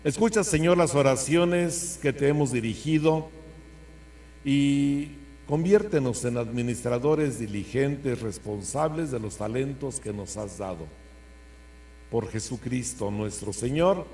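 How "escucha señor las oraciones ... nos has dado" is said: loud, solemn prayer to God. Acted. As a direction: pray it out with full force. Closing prayer intoned.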